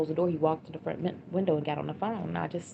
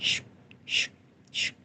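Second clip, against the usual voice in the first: sharp imitated "shh" swishes; short swishes of a blade, spaced out; stabbing noise. Mother imitating the stabbing she heard over the phone, stabbing noise. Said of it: "just like in the movies, it sounded like".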